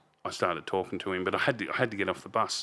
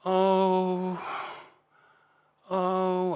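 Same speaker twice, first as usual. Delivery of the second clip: a high, drawn-out "oh" of grief, imitated; a mother's pained wail, retold; mother's anguished moan; 11 semitones higher than usual. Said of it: "she's just going".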